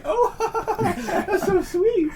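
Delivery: very high, laughing, cooing voice; mock swooning. Imitating women charmed by the name on his card, high laughing coo. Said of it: "And they're like".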